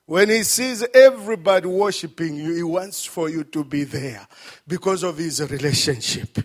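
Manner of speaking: loud, driving pulpit appeal; preacher pleading loudly with the church; full-force preaching. Acted. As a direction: press the point home to the crowd. Preaching climax to congregation.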